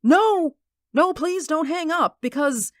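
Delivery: grab her before she hangs up; desperate plea. Acted. Begging her not to hang up.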